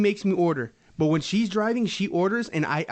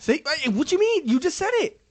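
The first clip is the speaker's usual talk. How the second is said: high mocking voice; 13 semitones higher, 3 dB louder than usual. Mocking high imitation of wife.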